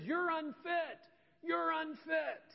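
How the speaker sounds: booming condemnation. Acted. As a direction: pronounce judgment from on high, drawn out at the end. Heaven voiced condemning the sinner.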